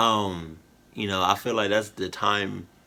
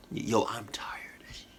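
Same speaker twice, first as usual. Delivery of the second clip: tired whisper; 7 dB quieter than usual. Real, not acted. Exhausted aside to camera.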